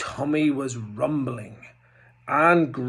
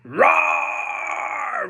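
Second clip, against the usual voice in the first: troll roar; 13 semitones higher than usual. Acted. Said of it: "roared the troll".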